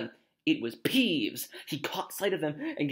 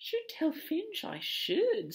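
slow, high mock-pious voice; saintly Peeves; 3 dB quieter, much slower than usual. Peeves threatening to tell on children, high saintly voice. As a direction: play the little saint, drawn out. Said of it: "said Peeves in a saintly voice".

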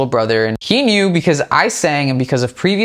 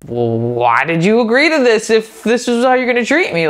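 much higher, incredulous retold complaint; a hurt, disbelieving outburst pitched up; exasperated; 9 semitones higher than usual. To the friend who ignores him, exasperated and higher.

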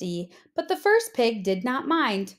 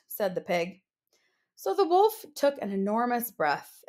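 usual speaking voice

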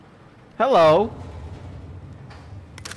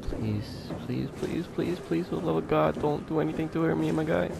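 soft pleading; 3 semitones higher, 8 dB quieter than usual. Real, not acted. Begging the game softly.

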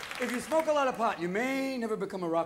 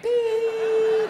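long, high, loud monitor tone; sustained piercing beep of a heart monitor; flatline beep; 13 semitones higher, 9 dB louder than usual. Acted. Imitates heart monitor after flatline pun.

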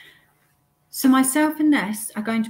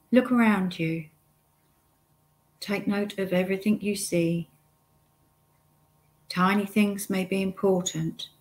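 guided meditation. Acted. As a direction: lead the listener step by step, unhurried, pausing often.

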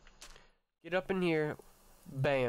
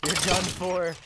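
gloating taunt; 4 dB louder, slower than usual. Real, not acted. Taunting mobs he has trapped.

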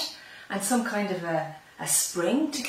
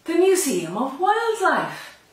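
excited reveal; 7 semitones higher, 7 dB louder, slower than usual. A narrator's reading. Dramatic reveal of destination.

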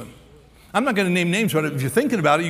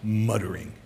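low, softer mutter; 7 semitones lower, 6 dB quieter than usual. Shown as a demonstration. Preacher shows the congregation what the word does, muttering. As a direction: drop "muttering" down under your voice. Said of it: "You can't mutter without".

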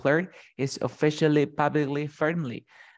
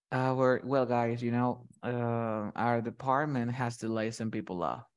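downcast, halting delivery, trailing softer; sad bemoaning; 2 semitones lower, 4 dB quieter than usual. Shown as a demonstration. Boss telling staff about layoffs, sad and halting. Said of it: "look at the way that I'm speaking".

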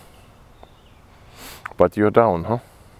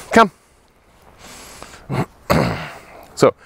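ordinary delivery

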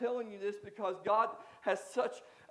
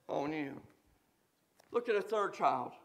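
ordinary talk, nothing marked

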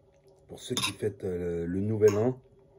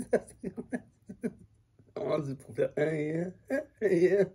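squeaky playful voice; 7 semitones higher than usual. Pretend. Trying out his new signature.

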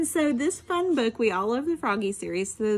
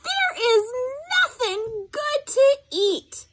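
high, whining complaint; whiny yell; 12 semitones higher, 3 dB louder, slower than usual. Acted. Froggy complaining to Mom there's no food, high whine. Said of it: "yelled Froggy".

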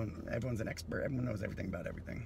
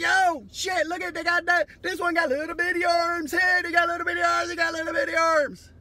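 much higher, louder, hammed-up yelling; excited discoverer voice; 12 dB louder than usual. Comedian voicing people finding dinosaur bones, high excited yell. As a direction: squeal like a kid who found a fossil. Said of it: "and we're like".

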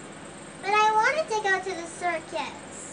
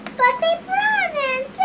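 upset whine; 8 semitones higher, 4 dB louder, slower than usual. Acted. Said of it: "She walked away crying".

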